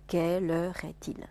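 "quelle heure est-il" in separated, equal syllables; even syllables. Shown as a demonstration. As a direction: model "quelle heure est-il" evenly, beat by beat. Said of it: "每个音节平均分配就可以了". (音节平均分配示范)

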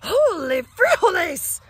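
high, slow, loud exclamation; loud cry. Acted. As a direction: shout it in alarm. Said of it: "cried Skipito".

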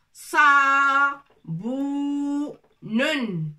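"Sa" loud, "bou" and "nun" held long; syllable by syllable. Shown as a demonstration. Teacher sounding out saboun for the pupils. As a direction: build the word one long syllable at a time.

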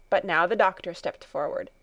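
plain reading voice

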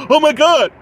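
high, loud mock "oh my God"; mock outburst. Reviewer mimicking Austin confronting Sean, loud mock outrage. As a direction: imitate an outraged overreaction. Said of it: "And then you're like".

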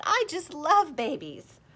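high, loud, slow cooing; doting old lady cooing at a toddler; gushing granny. A narrator's reading. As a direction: coo over the baby like a doting grandma. Grandmotherly guest gushing over baby.

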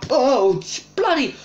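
high, loud scream of fright; startled scream. Real, not acted. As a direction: yelp at the jump scare. Jump scare in horror game.

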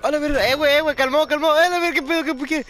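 high, loud frantic yelling at the screen; panicked yelling. Real, not acted. Gamer yelling at his teammate in panic, high and loud. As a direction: yell in real panic as enemies rush in.

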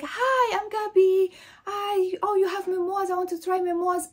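high-pitched, gushing self-imitation; mock-excited, high shop-visitor greeting; eager greeting. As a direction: introduce yourself in a high, overeager chirp. Acts out her eager shop greeting.

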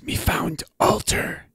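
slow, hushed stage whisper; character whisper. Acted. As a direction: whisper it slowly to a companion. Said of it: "looks back at Zatasha and whispers".

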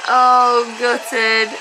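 long loud oh, then drawn out; dismayed groan. Real, not acted. Viewer seeing the end go wrong, dismayed wail. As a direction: wail it slowly in sympathy. Dismayed at slip in final lift.

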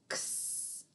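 unvoiced ks hiss for X; X sound. Shown as a demonstration. Teacher sounding out X for kids. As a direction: breathe out X as a soft ks. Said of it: "They make the sound".

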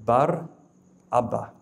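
Bar held, then Abba after a pause; slowly split. Shown as a demonstration. Preacher repeating the name to the crowd, slowly split. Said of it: "the word slowly".

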